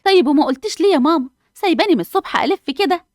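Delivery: why didn't you tell me, whined high; exasperated daughter. Acted. Hanaa reproaches her mother. (عتاب بصوت عالي ومرتفع)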